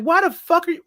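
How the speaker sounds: high, baffled outburst; disbelieving. Retold or imitated. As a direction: ask it in shock. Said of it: "I was like".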